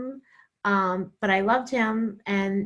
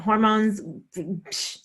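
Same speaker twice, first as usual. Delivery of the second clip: normal talking voice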